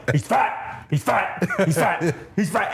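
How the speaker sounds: mock yell at everyday volume; imitated yelling. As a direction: repeat it like a chant yelled mid-chase. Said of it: "he's just literally yelling".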